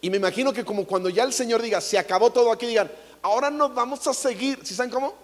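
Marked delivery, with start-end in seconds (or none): none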